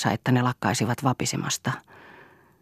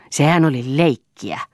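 slow, louder, irritated retort; snarled retort; 2 semitones higher, 5 dB louder than usual. A narrator's reading. Eero brushing off the hit on Lea, snarling. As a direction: bark it out, drawn out and annoyed. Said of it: "ärjäisi Eero".

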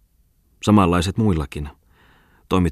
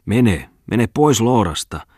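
slow, louder, higher angry yell; shouting; 5 semitones higher, 4 dB louder, slower than usual. Acted. Tommola driving the girl out, angry shout. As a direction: throw her out with a shout. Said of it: "huusi oiva Tommola".

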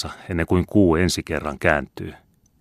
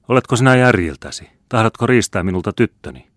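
high, loud incredulous challenge; raised voice, affronted question; indignant outburst; 6 semitones higher, 6 dB louder than usual. A narrator's reading. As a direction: protest sharply, starting at full height. Indignant quarrel over the girl.

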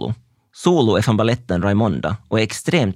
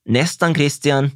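teacher's call; 6 semitones higher, slower than usual. Retold or imitated. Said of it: "lärarens rop".

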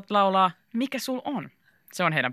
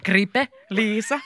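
ordinary delivery

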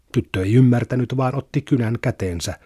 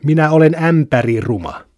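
a louder outburst with a raised pitch; shouting girl; 4 semitones higher, 6 dB louder than usual. A narrator's reading. Kaisa shouting at her silent parents, loud outburst. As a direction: yell it out in frustration. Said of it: "Kaisa huusi".

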